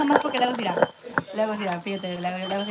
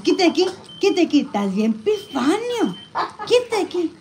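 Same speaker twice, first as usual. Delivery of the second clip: 1 mock scolding; 6 semitones higher, 3 dB louder than usual.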